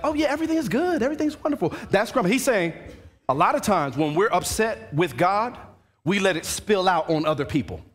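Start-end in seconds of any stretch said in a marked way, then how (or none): 0.0-1.7 much higher, sugary public face; fake cheerful. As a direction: put on a fake happy front. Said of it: "you're like".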